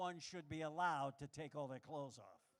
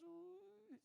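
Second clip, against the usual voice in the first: high taunting ooh; 13 semitones higher, 11 dB quieter than usual. Acted. Beetle taunting noise.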